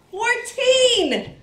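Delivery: high laughing announcement; excited laughing shout. Real, not acted. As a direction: blurt the number out laughing.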